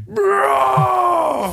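high outcry of disbelief; stunned shouted bro. Real, not acted. Outcry at the limo flip.

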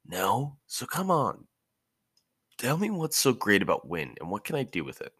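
put-on a restless kid pestering his sensei; eager boy Naruto.

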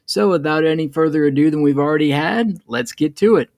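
loud, upbeat show-opener read; podcast intro. Acted. Host opening the podcast for listeners, loud announcer read.

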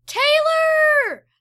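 high, loud, drawn-out name call; excited greeting. Acted. Roberto and Noah spotting Taylor, high excited call. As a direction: call her name in glad surprise. Boys greet arriving friend.

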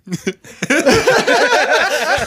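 high loud laughter; real high laughter; laughing. Real, not acted. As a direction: burst out laughing. Laughs at own dream.

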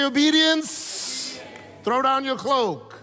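OBEDIENCE stretched slow, high and loud; drawn-out proclamation. Shown as a demonstration. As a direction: hold "Obedience" long for the crowd to echo. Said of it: "Everyone say".

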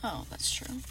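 muttered whisper; a quiet whispered concession. Real, not acted. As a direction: whisper it to yourself. Quiet realization aside.

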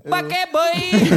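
high, loud mimicked yell of "Pake boy!"; mock shouted call. Friend retelling pickers' shout, high loud mock yell. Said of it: "kun ne huutaa".

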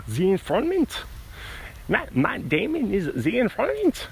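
high, hammed-up German accent. Shown as a demonstration. Podcaster returning to the movie topic, German accent. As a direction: announce it like a German movie trailer. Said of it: "you have to say it in a German accent".

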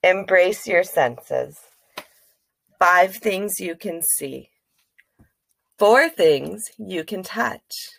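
slow, calm guided grounding voice; calm grounding exercise. Shown as a demonstration. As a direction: count down the senses gently.

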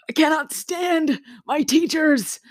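high, drawn-out wail of exasperation; exasperated outburst. Real, not acted. Student venting about teachers, exasperated outburst. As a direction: let it burst out high and slow.

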